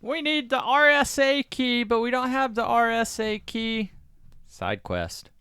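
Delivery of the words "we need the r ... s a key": high, loud cartoonish plot voice; mock exposition. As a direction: parody a film character announcing the problem. Mocks the film's plot exposition.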